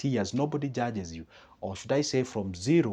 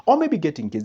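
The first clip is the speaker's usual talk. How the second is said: ordinary talk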